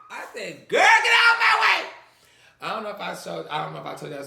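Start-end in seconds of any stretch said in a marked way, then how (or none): 0.7-1.9 in the knife fight, a high yell; high shout. Retold or imitated. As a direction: shout her out of the way.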